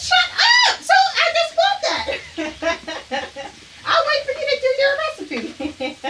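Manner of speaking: high, loud excited squeal; sister squealing. Retold or imitated. Cook imitates her sister squealing about the same steak.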